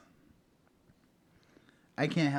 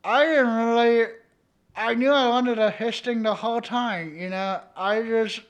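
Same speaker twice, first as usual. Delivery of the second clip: high, loud silly puppet voice; comic confessing puppet, pitched up; puppeteered dummy voice; 6 semitones higher, 6 dB louder than usual. Acted. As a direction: talk as the hapless puppet on someone's hand. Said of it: "I'll be puppeteering them and be like".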